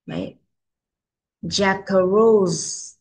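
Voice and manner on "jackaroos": "Jackaroos" very slow with a clear final s; sounded final S. Shown as a demonstration. Teacher drills children on endings, final s of "Jackaroos" fully sounded. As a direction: stretch "Jackaroos" and finish the hissing ending. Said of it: "Chỗ nào có sờ các con nhớ đọc hết ra".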